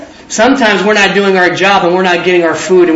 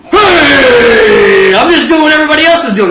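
defensive protest; 5 semitones higher, 4 dB louder than usual. Acted.

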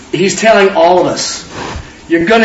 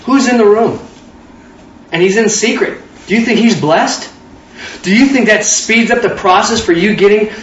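normal talking voice

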